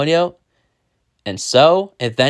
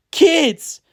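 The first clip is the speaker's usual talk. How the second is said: high, relieved exclamation; said smiling; 9 semitones higher than usual. A narrator's reading. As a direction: greet the children in a raised, happy voice. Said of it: "he said, smiling".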